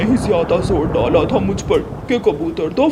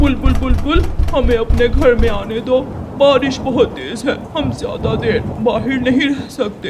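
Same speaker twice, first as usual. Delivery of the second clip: calling out, pleading; 4 semitones higher than usual. Acted. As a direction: shout her name and beg to come in. Calling out in rain. (बारिश में ज़ोर से पुकारते हुए विनती)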